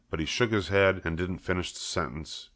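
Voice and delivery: plain reading voice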